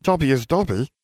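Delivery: lightly voiced nervous elf. A narrator's reading. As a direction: answer shyly as a small creature. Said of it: "he said nervously".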